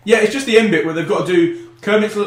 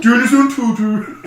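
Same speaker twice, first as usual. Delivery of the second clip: high cartoon Swedish sign-off; mock Swedish; 7 semitones higher, 4 dB louder than usual. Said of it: "today's Swedish podcast".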